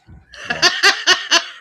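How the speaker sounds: high loud giggle; short high laugh at own joke. Real, not acted. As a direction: burst out laughing.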